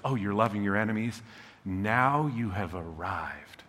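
a hint of a pleased, tender God; gentle voice of God. God celebrating loving your enemies.

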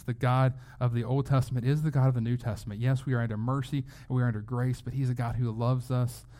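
normal talking voice

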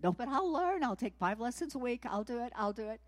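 loud and high, then settling; eager promise. Retold or imitated. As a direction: insist eagerly, calming down. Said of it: "might have said".

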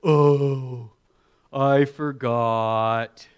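very slow loud drawn-out excuse; mock childish excuse; child's excuse. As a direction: whine the lame excuse. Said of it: "he has never said".

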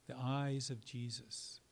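usual speaking voice

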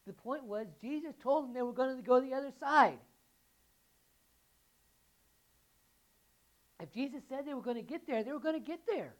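high and loud, hammering the point; preaching at full force. Acted. Pastor to congregation, emphatic preaching. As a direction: drive it home with conviction.

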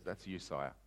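low, very soft reveal; quiet accusation. Acted. Nathaniel confronting the king, quiet accusation. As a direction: drop right down and say it softly.

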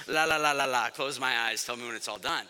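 low, quick la-la-la under the breath; tuning it out. Retold or imitated. Nervous sailing passenger blocking out the tilting boat, low muttered la-la-la. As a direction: drop low and babble it to shut things out. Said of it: "You know, I'm just".

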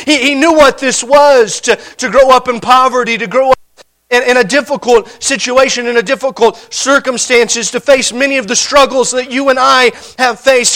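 normal talking voice

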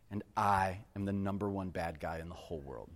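ordinary delivery

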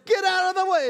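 an annoyed driver shouting up the line; impatient driver. Retold or imitated. Same driver shouting in traffic.

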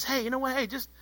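hushed, lifted "easy now" tone; mock soothing. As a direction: gently talk down someone who is all worked up. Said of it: "get to be the person that says".

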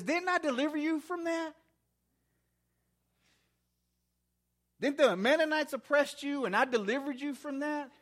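high-pitched rhetorical questioning as God; challenging reproach. Acted. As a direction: press each question home, voicing an indignant God. Preacher voices God challenging the people.

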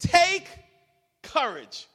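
high, loud repeat; rousing call. Acted. Preacher urging the congregation to respond. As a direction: call it out to rouse them. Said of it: "Come on".

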